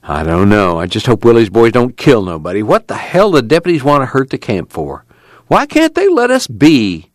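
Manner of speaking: Okie voice climbing into a high complaint; worried camp man. Acted.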